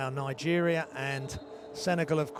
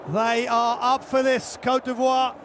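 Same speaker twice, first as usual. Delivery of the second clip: excited shouting; 9 semitones higher, 4 dB louder than usual. Real, not acted. Slam dunk in live commentary.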